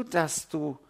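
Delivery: ordinary talk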